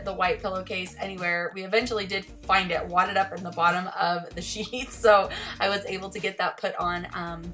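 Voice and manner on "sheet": usual speaking voice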